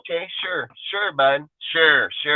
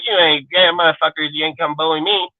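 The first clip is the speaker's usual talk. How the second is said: ordinary delivery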